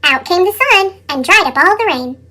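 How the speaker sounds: ordinary delivery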